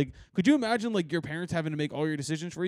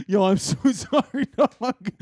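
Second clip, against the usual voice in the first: laughing apology; 3 semitones higher, 7 dB louder, slower than usual. Retold or imitated. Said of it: "I was like".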